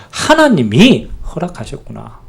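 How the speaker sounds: 하나님이 허락하셨구나 said slowly, savoring 하나님이; slow down and land on 하나님이 as the subject; slow realization. Shown as a demonstration. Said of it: "주어입니다, 주어".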